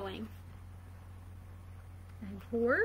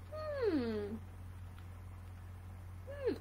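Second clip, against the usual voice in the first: plain reading voice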